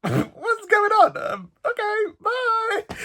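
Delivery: high, slow, nervous squeak; awkward squeak. Real, not acted. Vlogger backing away from the rude old man, high slow squeak. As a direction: squeak your way out of the awkward moment.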